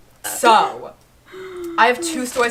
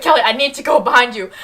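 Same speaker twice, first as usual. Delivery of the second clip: urgent call; 7 dB louder, faster than usual. Retold or imitated. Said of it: "And I was like".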